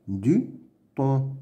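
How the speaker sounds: "Du thon" with a pause after "Du"; "Du" and "thon" as two separate beats; syllable by syllable. Shown as a demonstration. Teacher sounding out words to read, "Du thon" in separate pieces. Reading drill, split then blended.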